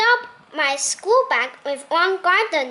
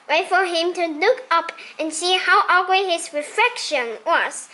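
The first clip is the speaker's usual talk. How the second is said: ordinary delivery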